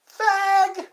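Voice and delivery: high, loud drawn-out mock yell; imitated kid's yell. Kid riding by a man's house, imitated yell. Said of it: "he would yell".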